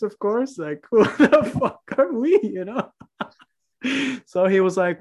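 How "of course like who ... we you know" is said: laughing through the words. Real, not acted. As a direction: giggle it out, amused at yourself. Laughing at own bad luck.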